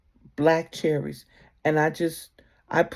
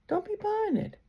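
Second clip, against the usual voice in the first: "Don't be buying it" lifted much higher and softer; higher insistent warning; 6 dB quieter than usual. Pretend. Guides warning her in the store, higher and insistent. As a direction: repeat the guides' warning up high, pressing.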